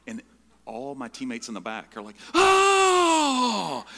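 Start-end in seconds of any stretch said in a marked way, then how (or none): 2.3-3.8 long, very high loud scream; imitated panicked yell. Teammates in the back as the plane banks, imitated scream. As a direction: mimic a cabin full of screaming passengers. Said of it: "And they're ye-, yelling".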